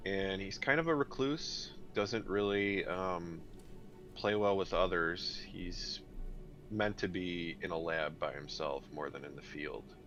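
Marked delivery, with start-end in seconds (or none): none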